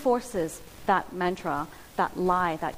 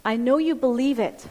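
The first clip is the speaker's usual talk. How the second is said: louder, driving preacher's build; preaching at full force; 4 semitones higher, 4 dB louder than usual. Acted. Pastor urging the congregation, full-force preaching. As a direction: press each "we better have seen" harder.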